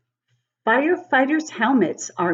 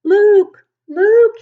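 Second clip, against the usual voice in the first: loud, high call across a smoky house; 14 semitones higher, 12 dB louder than usual. Acted. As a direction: shout the name to find the boy. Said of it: "he calls".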